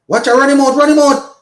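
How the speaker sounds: high, loud shout to run him out; urgent shout. Real, not acted. Calling out during run-out.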